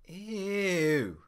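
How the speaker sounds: high, recoiling yelp of disgust. Acted. Man sees his greasy sandy shoes, disgusted squeal. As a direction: recoil from something gross. Seeing greasy shoe bottoms.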